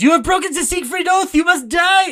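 very high, loud, ranting accusation; furious Nazi. Retold or imitated. Playwright storming in to shoot the producers, furious shout. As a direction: bellow it like an unhinged fanatic. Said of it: "Franz Liebkin comes in and goes".

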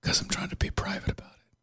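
hushed, soft explanation; secretive whisper. Acted. Mayor explaining his secrecy to a colleague, secretive whisper. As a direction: whisper to keep it private. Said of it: "So why are we whispering?".

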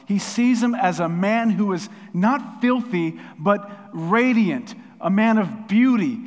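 loud, high, emphatic preaching; impassioned preaching. Real, not acted. Preacher telling how God sees Joshua, impassioned. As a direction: preach it with rising force and drawn-out key words.

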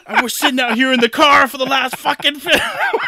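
high, loud rant breaking into laughter; mock angry yelling. Wife fed up with waiting in the car, hammed-up yell. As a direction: imitate a furious wife, cracking up as you go. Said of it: "he could hear her yelling".

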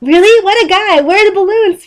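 very high, excited cartoon voice; SpongeBob. A narrator's reading. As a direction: squeal it with wide-eyed excitement. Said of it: "SpongeBob said".

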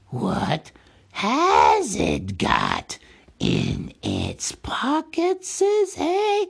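high, slow wheedling creature voice; sly Gollum voice. A narrator's reading. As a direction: dodge the promise like a sneaky cave thing. Gollum evading promise.

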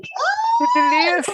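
high squealed delight; delighted shriek. Real, not acted.

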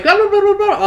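high, rapid-fire nonsense chatter; imitated crowd babble. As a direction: imitate everyone talking in a panic. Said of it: "everyone's like".

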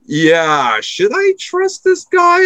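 mock inner doubt, drawn out; doubtful LeBron James. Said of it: "you're like".

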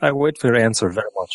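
a light, half-hearted Russian accent on the spam letter; hint of a Russian accent. Shown as a demonstration. Reading a Russian spam letter aloud, faint mock Russian accent. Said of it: "done it in the correct accents".

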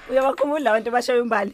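laughing. Real, not acted. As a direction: laugh while agreeing. Host reacts to listener's voice note.

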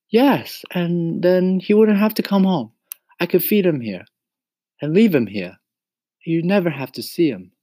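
put-on high-pitched, excited boyish plea; pleading boy Mario. A narrator's reading. Mario begs Mama to let the cricket stay, pleading.

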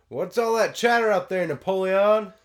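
high, loud, blustery uncle; Uncle Rico voice. Acted. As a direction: bark it down like Uncle Rico from the house. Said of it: "my Uncle Rico voice is pretty good".

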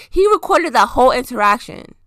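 normal talking voice

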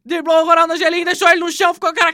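building from calm to a shouted goal; explosive goal commentary. Acted. Building call of Neymar's goal.

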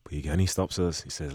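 usual speaking voice

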